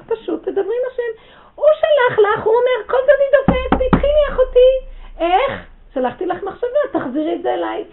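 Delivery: loud, high preaching cry; shouting God's call. Acted. Preacher voicing God calling to his sister, loud cry.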